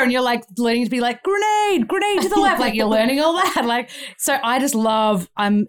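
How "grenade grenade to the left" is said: high-pitched urgent call-out, hammed up; acted alarm, pitched way up; mock battle warning. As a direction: cry out like a video game soldier. Voiceover class battle shout demo.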